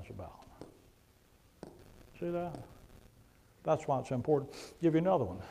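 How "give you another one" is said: normal talking voice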